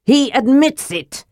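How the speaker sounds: high, exultant crow; triumphant cry. A narrator's reading. Accuser seizes on the dwarf's admission, triumphant cry. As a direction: pitch it high, crowing in triumph. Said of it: "Raelgar cried in triumph".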